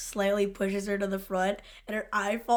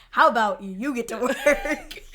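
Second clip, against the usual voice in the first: slow, louder mock boss with a laugh; mock bossy boss; 3 semitones higher, 7 dB louder than usual. Boss scolding his detective, slow and loud.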